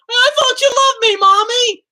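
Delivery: very high, loud, tearful wail; mock crying scream. Pranking his mother in the parking lot, loud mock sobbing. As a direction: wail it as a betrayed child. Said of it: "I started screaming".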